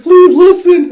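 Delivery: very high, very loud acted yell; hammed-up frantic wail; desperate plea. Girl pleads as horse takes off.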